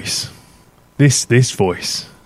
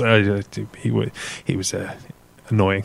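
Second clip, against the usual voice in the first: ordinary talk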